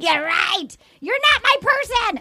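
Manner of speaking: high, wounded retort, climbing in pitch; mock hurt retort. Woman retold answering her ex, high hurt retort. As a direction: throw it back at him with mock hurt.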